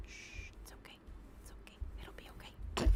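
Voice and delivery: playful soothing whisper; mock ASMR lullaby. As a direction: lull them to sleep, jokingly. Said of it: "let my raspy voice lull you into a sleep".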